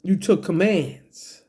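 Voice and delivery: usual speaking voice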